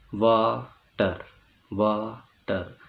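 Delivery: वाटर split in two, वा, then टर, very slow; syllable by syllable. Shown as a demonstration. Teacher showing the hurdle in वाटर, slowly split in syllables. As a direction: say वाटर with a clear break after वा. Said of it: "एक hurdle का सामना करती है".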